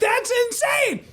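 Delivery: very high, slow, stunned; amazed disbelief. Retold or imitated. Comic marvels at being paid to stay home, amazed.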